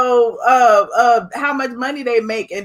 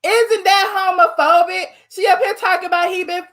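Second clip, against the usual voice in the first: high, incredulous and half-laughing; shocked disbelief; 5 semitones higher than usual. Real, not acted. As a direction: gasp at what was just said. Shocked reaction to insult.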